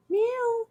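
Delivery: high cute meow at the cat. Pretend. Meowing to coax the cat down. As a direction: mimic a kitten's meow.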